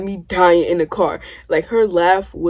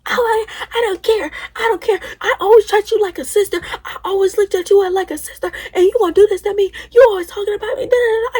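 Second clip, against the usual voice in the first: imitated screaming; 12 semitones higher than usual. Cousin screaming she always treated her like a sister, high tearful mock scream. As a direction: wail it like a betrayed sister. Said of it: "just screaming, like".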